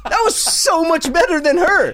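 high, loud, laughing praise. Real, not acted. Host cracking up at co-host's accent impression, laughing.

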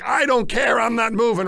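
tired hiker refusing to leave the cave, loud and stubborn; stubborn outburst. Retold or imitated. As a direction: refuse flatly, half-joking but loud.

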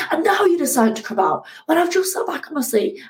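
high-pitched indignant yell at the sun; hammed-up outrage, shouted at the sky; mock angry shout. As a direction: rant at the sun like it did it on purpose. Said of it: "I could just shout at the fact that the sun came out".

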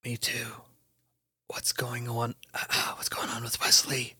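breathy unvoiced whisper. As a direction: keep it hushed so they don't hear. Whispering while pretending to sleep.

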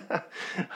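high breathy chuckle; a quick airy laugh at himself. Real, not acted. Laughing at own DNA story.

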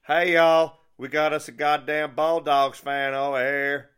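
big, drawn-out Southern holler; yelled Southern drawl. Acted. Waitress alerting every employee in the Waffle House, yelling in a drawl. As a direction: yell to the whole room, high and very loud, Southern. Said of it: "and then yelled out".

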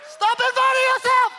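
loud, high preacher's cry; full-force sermon shout; preaching. Acted. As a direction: shout the lesson home.

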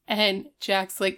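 ordinary talk, nothing marked

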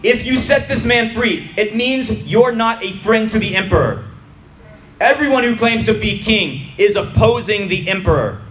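insistent public warning, pitched high; crowd shouting. A narrator's reading. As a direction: shout it at the governor. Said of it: "the Judeans shouted".

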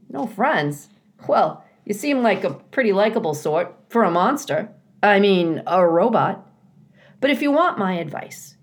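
kindly beaver catching himself. A narrator's reading. Mister Beaver speaks.

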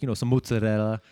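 mozzarella as "moot-sa-RELL-a", imitating him; affected Italian pronunciation. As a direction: give mozzarella an Italian "ts" and tapped r. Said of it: "some of the Italian stuff".

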